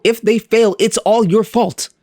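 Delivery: playfully pin the blame in a high-pitched voice; mock blame. Mock blame, then "I'm joking.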